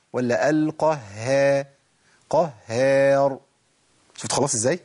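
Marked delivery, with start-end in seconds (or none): 0.5-3.4 very slow "قهار" with a light front haa after heavy qaf; thin, light ha. Shown as a demonstration. Teacher offers the correct alternative, light ha. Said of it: "يفخم مني".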